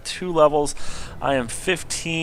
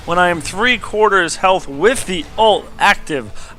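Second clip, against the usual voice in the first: higher, louder caster call of the fight; excited play-by-play; 5 semitones higher, 6 dB louder than usual. Acted. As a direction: call the action like a live caster. Live play-by-play of replay.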